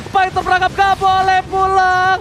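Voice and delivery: fighting game announcer. Real, not acted. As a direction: hype play-by-play call. Hype play-by-play call.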